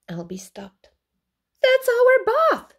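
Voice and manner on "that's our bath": very high, loud shocked cry. A narrator's reading. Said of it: "he said".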